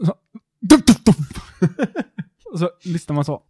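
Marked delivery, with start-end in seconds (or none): 0.6-1.2 three loud, high thumping noises; imitated thuds. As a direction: punch out each bump as a sound effect. Imitates tram bumping over someone. (härmade dunsar, högt och hårt)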